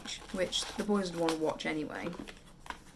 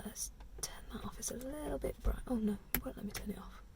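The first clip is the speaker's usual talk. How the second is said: whispering; 4 semitones higher, 9 dB quieter than usual. Real, not acted. Fiddling with camera light.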